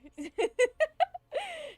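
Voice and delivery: a high, loud burst of laughter. Real, not acted. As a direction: break into laughter. Streamer laughing.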